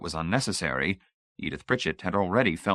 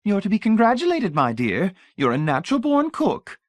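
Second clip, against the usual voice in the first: put-on high, honeyed old lady voice; sugary old lady; 12 semitones higher, 4 dB louder than usual. A narrator's reading. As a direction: pour on the sweetness like a grande dame. Voices Mrs Pritchett.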